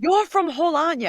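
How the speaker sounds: thrilled to spot a fellow Hull local; excited. Retold or imitated. Telling a friend about a Hull stranger, high excited question.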